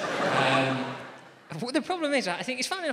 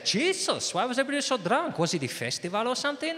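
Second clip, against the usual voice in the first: higher, astonished Spanish friend; Spanish accent; 11 semitones higher than usual. Acted. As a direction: ask in shock with a Spanish accent. Spanish friends ask about the trip.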